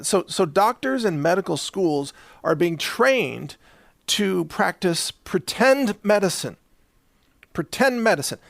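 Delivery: ordinary talk, nothing marked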